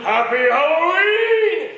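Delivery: loud, high, slow monster roar; drawn-out scary holler; monster greeting. Retold or imitated. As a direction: boom it out to scare kids. Stuffed dummy springs to life.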